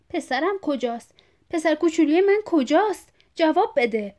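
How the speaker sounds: father bellows for his little son; angry demand. Acted. Bleeding father shouting angrily for his lost son.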